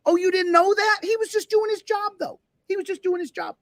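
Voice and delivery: high sarcastic whine; mocking voice. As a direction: mimic the cop's defenders mockingly. Mocking defenders of the cop.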